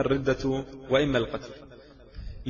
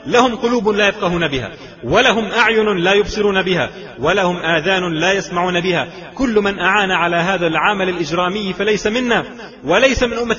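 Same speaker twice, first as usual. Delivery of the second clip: loud, raised-pitch preaching at full force; fiery denunciation; 3 semitones higher, 5 dB louder than usual. Real, not acted. Preacher condemning those who aided the crime, fiery denunciation. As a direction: thunder it out phrase by phrase.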